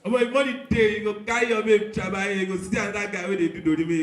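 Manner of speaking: ordinary talk, nothing marked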